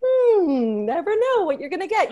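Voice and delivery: high, loud mock musing; imitated reaction. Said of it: "And Kate was like".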